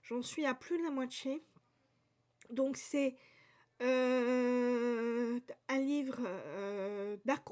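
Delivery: usual speaking voice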